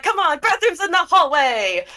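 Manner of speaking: loud, high pushy call over the party; hurried shout. Acted. Atticus shoving them through the party hallway. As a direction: hustle them along over the noise. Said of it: "she's like".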